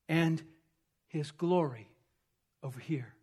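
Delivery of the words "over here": ordinary talk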